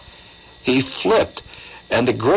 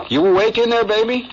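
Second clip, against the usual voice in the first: teasing call-out; 12 semitones higher than usual. Real, not acted. Calling out to listener.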